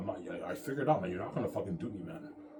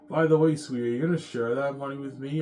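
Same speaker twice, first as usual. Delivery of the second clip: higher, louder put-on wife voice, sweetly pressing; mock wife voice; 6 semitones higher, 9 dB louder than usual. Husband mimics his wife asking him to share the money, in a higher, louder mock voice. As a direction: put on the wife's voice to ask for a share.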